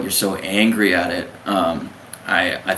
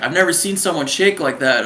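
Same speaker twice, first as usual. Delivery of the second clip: raised-pitch astonished officer; acted disbelief, pitched up; mock incredulous chief; 7 semitones higher than usual. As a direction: imitate an officer who can't believe it. Acted chief amazed at shaking.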